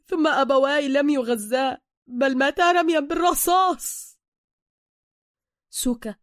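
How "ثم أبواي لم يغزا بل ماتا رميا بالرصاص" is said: loud, high, anguished outburst; indignant protest. A narrator's reading. Bruce insisting his parents were shot, indignant shout. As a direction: shout it in formal Arabic, drawing out the ending.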